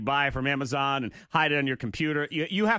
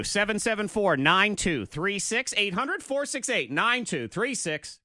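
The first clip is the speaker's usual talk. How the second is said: radio announcer read; 6 semitones higher, slower than usual. Acted. Radio phone number read.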